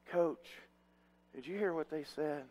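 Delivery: softly voiced kid asking coach; hushed, hesitant imitation of players; imitated timid kids. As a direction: ask the coach quietly, as a worried player. Kids nervously asking their coach.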